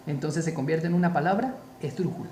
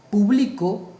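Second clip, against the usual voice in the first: Publicó said louder, stress off the PÚ; contrasting word stress; 3 semitones higher, 6 dB louder, slower than usual. Shown as a demonstration. Teacher contrasting examples, Publicó said with marked stress. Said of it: "dependiendo dónde lleva el acento".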